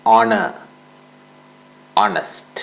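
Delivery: honor said "onor", with no h; silent h. Shown as a demonstration. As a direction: honor and honest starting on the vowel. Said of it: "ഒന്നുകൂടി pronounce ചെയ്യാം". (എച്ച് ഉച്ചരിക്കാതെ)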